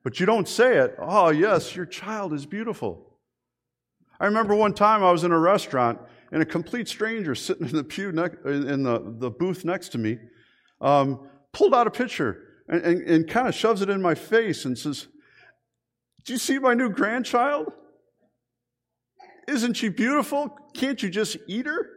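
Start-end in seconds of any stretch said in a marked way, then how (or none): 1.0-3.2 forced politeness. Retold or imitated. 16.2-17.7 nada tinggi penuh antusias; high, delighted gushing question; excited grandmother. Retold or imitated. 19.5-21.9 gushing stranger. Retold or imitated. Stranger gushing over grandchild.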